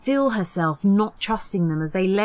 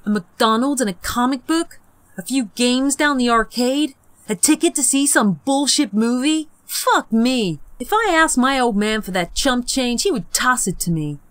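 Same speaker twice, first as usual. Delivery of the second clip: put-on loud, higher ranting boy; indignant rant; 6 semitones higher, 4 dB louder than usual. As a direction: rail against the chump change. Peter ranting about pay.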